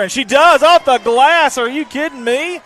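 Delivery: building to a high, loud shout; thrilled, disbelieving play-by-play; astonished scoring call. Real, not acted. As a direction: erupt as the shot drops. Calling a bank shot live.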